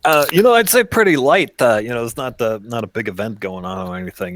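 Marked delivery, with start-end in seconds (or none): 0.0-1.6 nervous hostage voice. Acted. GM voices Rick on phone.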